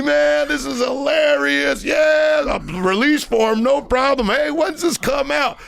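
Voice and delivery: barber suddenly laughing it off, all friendly; relieved delight. Retold or imitated. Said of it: "went from a murder rage to being like".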